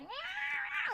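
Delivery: very high imitated cat yowl; long, high mock meow-scream. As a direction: screech like a cat being chased. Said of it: "she is screaming all throughout the house".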